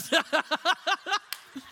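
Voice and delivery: high, rising cackle; laughter. Real, not acted. Preacher after his encounter-with-God joke, rising laughter. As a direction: crack up at your own punchline.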